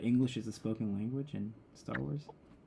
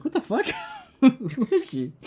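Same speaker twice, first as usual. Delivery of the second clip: high, loud, laughing incredulous outburst; mock disbelief; 10 semitones higher, 4 dB louder than usual. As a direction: play baffled outrage over a podcast joke. Mock outrage at Leia's accent.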